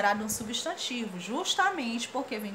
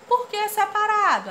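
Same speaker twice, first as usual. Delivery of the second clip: high asking voice; 10 semitones higher, 7 dB louder than usual. Acted. Asker repeats question, higher.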